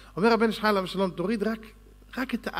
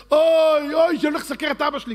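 higher and louder, a fast wailing oy; crying out oy; 9 semitones higher, 7 dB louder than usual. Retold or imitated. Jacob on the way to his father, crying out oy. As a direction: wail it like Jacob on the road. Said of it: "ככה היה צועק".

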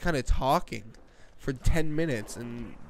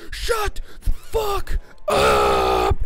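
low, slow, roaring shout; furious parent; 13 semitones lower, 8 dB louder, much slower than usual. Retold or imitated. Parents yelling at screaming gamer, low furious bellow. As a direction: bellow it low, dragging each word. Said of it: "My parents gonna be like".

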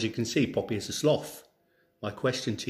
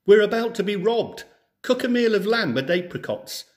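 higher and louder, in a hurry; urgent warning; 9 semitones higher, 6 dB louder than usual. Acted. Farmer rushes home to warn his wife, urgent raised voice. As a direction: sound the alarm to the wife.

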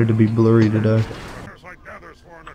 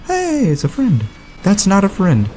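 high startled yelp; 9 semitones higher than usual. Real, not acted. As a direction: jump in alarm, voice shooting up.

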